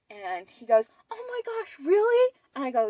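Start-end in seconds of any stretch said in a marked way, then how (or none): 1.1-2.3 much higher, with a loud jump on "really"; imitating surprised dad. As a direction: mimic the dad's stunned delight, pitched way up. Dad hears the pregnancy news.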